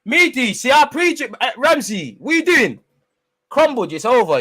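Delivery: high, loud triumphant jeering; loud, high mocking cheer at the taker; gloating shout. Real, not acted. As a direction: crow over the miss at the top of your voice. Gloating at a missed penalty.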